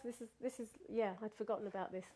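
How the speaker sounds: ordinary delivery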